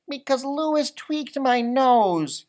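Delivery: put-on high, slow, put-upon boy's voice; a sulky little boy explaining himself; Gilbert's hurt excuse. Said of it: "Gilbert said".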